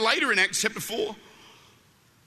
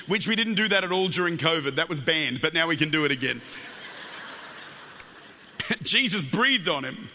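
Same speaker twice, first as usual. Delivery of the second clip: ordinary talk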